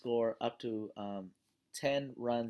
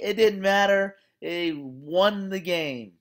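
louder, slower, triumphant; excited; 6 semitones higher, 10 dB louder, slower than usual. Real, not acted. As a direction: savour the win out loud. Celebrating the win.

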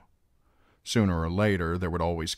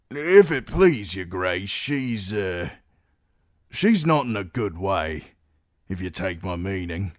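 deferential guard, starting louder then faltering; hesitant guardsman; 5 dB louder than usual. A narrator's reading. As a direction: break bad news awkwardly to the queen.

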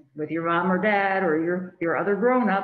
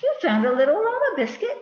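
put-on 1 little girl voice; 10 semitones higher, 4 dB louder, faster than usual. Little girl praising puppy.

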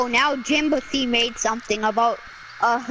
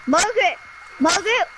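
calling out; 7 semitones higher, faster than usual. Real, not acted. Calling for lost character.

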